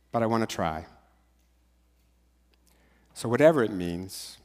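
prayer. Shown as a demonstration. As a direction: pray it softly, leaving pauses to repeat. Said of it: "Just repeat after me".

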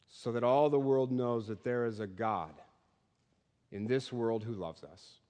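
soft, hushed prayer to close the sermon; a gentle, reverent benediction; closing prayer. Real, not acted. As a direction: pray it quietly over the congregation.

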